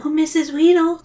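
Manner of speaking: put-on high girlish call. A narrator's reading. Zoe calling to her teacher, high call. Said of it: "Zoe calls out".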